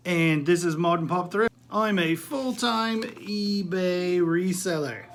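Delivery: mock British accent, drawn out slow; hammed-up British host, clearly fake; terrible British accent. Stay-at-home dad greets viewers in a bad British accent. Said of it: "I'm doing a terrible British accent".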